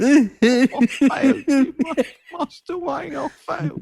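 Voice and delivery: high, weepy parody of a butler; hammed-up tearful apology; mock crying impression. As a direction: imitate an old actor breaking down. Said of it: "when he's crying. He goes".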